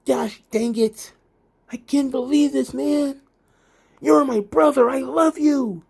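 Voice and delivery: mock emotional Roderick Strong pleading with his brother; gullible tearful brother. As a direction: play the goofy heartbroken friend. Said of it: "He's like".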